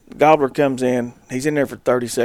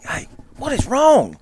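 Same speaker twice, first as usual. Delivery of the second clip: high, drawn-out baffled demand; exasperated; 11 semitones higher, slower than usual. Retold or imitated. Retelling his reply to panicked Brody, slow exasperated question. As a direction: act out exasperation, stretching it slowly.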